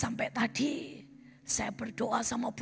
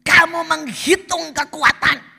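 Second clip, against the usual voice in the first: higher, much louder shouted accusation; God's rebuke; 5 semitones higher, 15 dB louder than usual. Acted. God accusing the proud pastor, shouted rebuke. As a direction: hammer each charge like thunder. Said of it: "Tuhan masih berkata".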